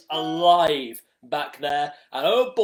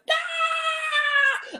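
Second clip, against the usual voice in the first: very high drawn-out mock scream; long, high-pitched comic "aah"; spiders' panicked scream. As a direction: imitate freaked-out spiders shrieking. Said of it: "and they went".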